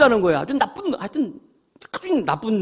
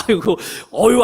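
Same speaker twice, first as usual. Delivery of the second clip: usual speaking voice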